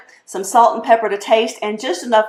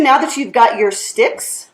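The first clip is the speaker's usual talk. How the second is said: usual speaking voice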